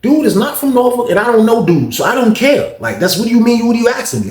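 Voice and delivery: high-pitched, indignant mock protest; indignant retort. Retells a dismissive, indignant reply.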